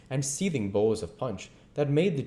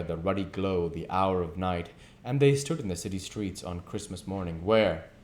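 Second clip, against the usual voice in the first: usual speaking voice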